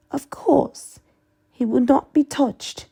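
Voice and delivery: high breathy reassurance; Bellatrix voice. Acted. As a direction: whisper it high and eager.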